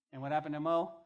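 ordinary delivery

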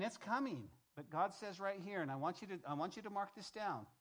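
normal talking voice

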